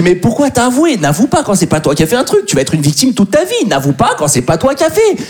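remontrance paternelle qui part dans l'aigu; engueule-la comme si c'était elle la fautive; reproche exaspéré. Acted. Père reproche à sa fille l'aveu. (père outré, voix haut perchée)

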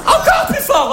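put-on very high girly cry; mock girlfriend. Girlfriend urging again.

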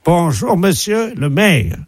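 pompous official greeting the mayor; mock ambassador voice. Prank caller plays Japanese ambassador.

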